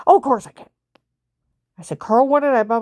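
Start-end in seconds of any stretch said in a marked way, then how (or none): 0.1-0.7 booming imitated old man answer; a loud hard-of-hearing husband shouting back; loud deaf reply. Carl answers loudly, hard of hearing. 2.0-2.8 raised voice to deaf man. Retold or imitated. Shouting at deaf husband.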